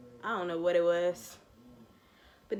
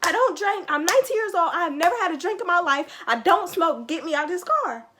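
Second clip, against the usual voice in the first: retold yell; 8 semitones higher, 5 dB louder than usual. Yelling at the woman who won't let her out of the car, furious. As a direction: yell it back like you're being kidnapped. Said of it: "and I just yelled".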